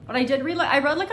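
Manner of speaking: high and loud insisting; protest, high and loud; emphatic. Real, not acted.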